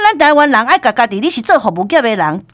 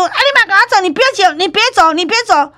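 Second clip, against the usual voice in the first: high, insistent imitated yelling; angry pursuit; 9 semitones higher than usual. Manager chasing the couple down the street, angry calls. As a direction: keep calling after them, getting more insistent. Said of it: "就是很生气".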